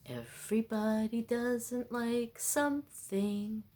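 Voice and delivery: slow, soft ad-read lilt; commercial voice. Retold or imitated. Quoting the Sara Lee ad, slow soft lilt. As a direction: croon it like the old ad. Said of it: "That was a commercial".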